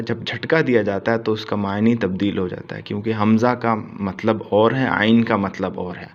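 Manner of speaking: ordinary talk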